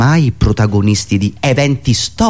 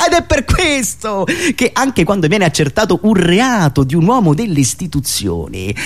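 normal talking voice

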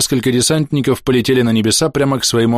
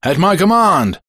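high shouted command in English; officer's shout; 10 semitones higher, 3 dB louder, slower than usual. A narrator's reading. British officer to his troops, shouting an order. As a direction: bellow an order to the ranks. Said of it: "закричал офицер".

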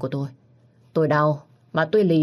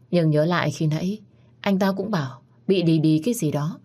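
plain reading voice

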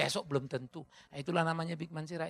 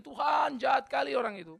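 slightly raised, petulant praying; mock vengeful prayer; 7 semitones higher, 6 dB louder than usual. Believer praying for enemies' harm, mock earnest. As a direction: pray like a spiteful believer. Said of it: "Kalau kita berdoa kan gitu".

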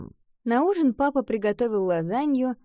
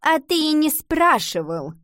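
higher, wounded comeback; piqued and accusing; stung retort; 8 semitones higher, 4 dB louder than usual. A narrator's reading. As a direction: throw the blame back, hurt. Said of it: "Голос Гундулы звучал уязвлённо".

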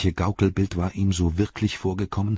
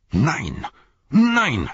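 screamed; 6 semitones higher, 4 dB louder than usual. A narrator's reading. Frodo refusing to let Sam carry the Ring, screaming. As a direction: cry of refusal leaping to a high, loud second word. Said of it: "schrie Frodo".

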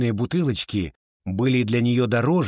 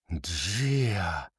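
hushed, lower murmur; softly voiced, almost whispered; near whisper; 4 semitones lower, 6 dB quieter than usual. A narrator's reading. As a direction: let the name drop low and soft. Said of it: "прошептала миссис Бадлер".

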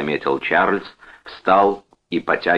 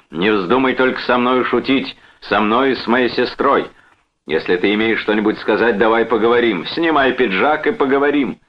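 higher, forceful challenge; mild narrated shout, spoiling for a fight; shouted warning; 6 semitones higher, 3 dB louder than usual. A narrator's reading. Brother blocks the door, shouting a challenge. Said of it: "и крикнул".

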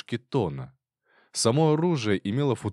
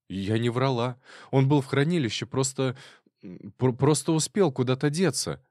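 rattling nervously; 5 semitones higher than usual. A narrator's reading. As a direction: blurt it out fast and scared. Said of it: "затараторила Тамара".

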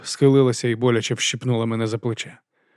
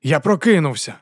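higher, louder protest; 5 semitones higher, 4 dB louder than usual. A narrator's reading. Narrator protesting to Kettle, loud. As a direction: insist you're awake. Protesting while ear pinched.

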